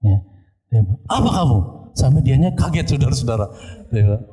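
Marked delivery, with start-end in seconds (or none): 1.1-1.6 high-pitched mock yell; teriakan orang kerasukan. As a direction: challenge sharply as the possessed person, voice pitched up. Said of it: "dia teriak ke". (pura-pura membentak dengan nada tinggi)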